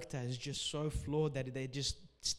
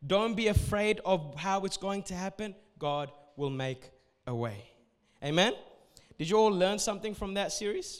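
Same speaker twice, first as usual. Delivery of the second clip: loud, high preaching push; rally the congregation with conviction; full-force preaching; 5 semitones higher, 6 dB louder than usual. Acted. Pastor urging the congregation not to fear, preaching loud.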